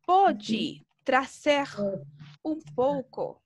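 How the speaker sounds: "Pode trazer" muy lento y claro; pídelo despacio y con cortesía; pregunta educada. Shown as a demonstration. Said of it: "para sonar un poco más educado". ("Pode trazer" dicho lento y educado)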